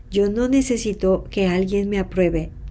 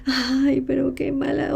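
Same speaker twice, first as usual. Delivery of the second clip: higher, the last words whispered in hurt; a wounded little cry to herself; imitating her own crying lament, a touch higher; tearful complaint; 5 semitones higher than usual. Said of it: "a llorar, y decir".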